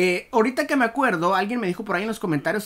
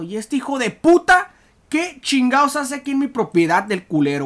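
loud, raised-pitch indignation; angry outburst; 4 semitones higher, 5 dB louder than usual. Real, not acted. Player spots an intruder on his property, angry outburst. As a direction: demand answers from an intruder, loudly.

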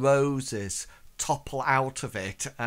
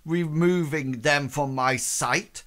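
scornful noblewoman ordering him gone, louder; contemptuous dismissal; 3 semitones higher, 6 dB louder than usual. Acted. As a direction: banish him with each line. Lady dismisses suitor in contempt.

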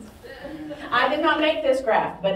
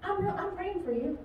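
softer, higher grandma voice; lightly voiced Southern grandma, softer and higher; sweet grandma; 6 semitones higher, 6 dB quieter than usual. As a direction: coo it like a praying grandmother. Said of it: "like Grandma talking to you".

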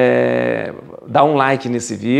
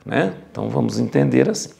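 ordinary talk, nothing marked

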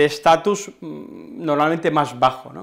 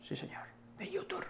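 much softer whispered aside; 23 dB quieter than usual. Acted. Teacher whispering to viewers that his channel is the best. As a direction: whisper it conspiratorially.

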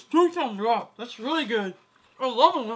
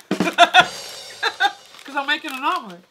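laughing; 4 semitones higher, 6 dB louder, slower than usual. Real, not acted. Laughing joke.

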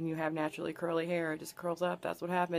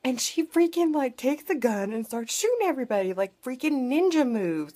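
higher, louder, thrilled rush of words; excited retelling; 5 semitones higher, 4 dB louder than usual. Real, not acted. Recounting the girl's ninja moves, excited. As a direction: gush over the action.

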